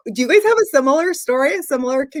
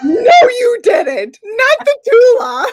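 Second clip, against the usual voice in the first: shocked disbelief; 10 semitones higher, 6 dB louder, slower than usual. Real, not acted. Co-host reacts to puppy throw.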